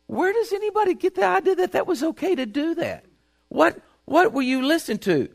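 high-pitched incredulous protest; exasperated disbelief. Retold or imitated. Incredulous reaction to government, quoted.

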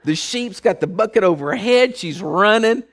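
ordinary talk, nothing marked

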